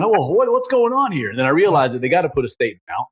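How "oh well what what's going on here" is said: baffled retort. Retold or imitated. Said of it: "I started going".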